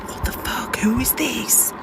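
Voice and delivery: hushed, breathy disbelief; spooked whisper. Real, not acted. Driver to the unknown voice in her car, whispering. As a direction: whisper it in alarm, barely voiced.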